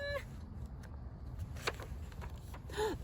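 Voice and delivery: soft excited gasp. Acted. Bird moving.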